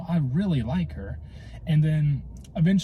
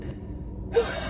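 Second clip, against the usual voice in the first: breathless sigh; 5 dB quieter than usual. Real, not acted. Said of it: "I'm out of breath".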